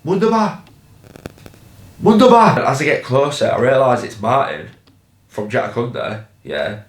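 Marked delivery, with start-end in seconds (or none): 0.0-2.6 put-on high kids' shout, calling out; mock children's chant, pitched up; kids shouting. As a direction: shout it like excited kids from the street. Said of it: "the voices of kids just, like, shouting".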